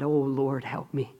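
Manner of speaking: low, hushed prayer trailing off; preacher acting a mother's faint plea; soft desperate plea. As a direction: beg quietly, almost spent. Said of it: "she cried out to God".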